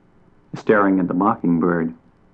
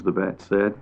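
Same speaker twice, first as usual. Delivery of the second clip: plain reading voice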